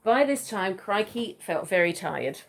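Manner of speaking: ordinary delivery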